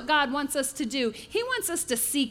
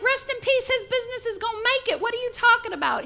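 a much higher, fast, exasperated outburst; aggravated; 10 semitones higher than usual. Acted. Businessman reading the funeral card from his friend, high indignant outburst. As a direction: squeal it out, fed up and baffled. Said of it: "He is aggravated".

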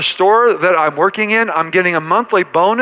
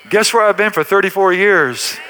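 ordinary talk

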